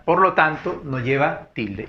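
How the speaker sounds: ordinary talk, nothing marked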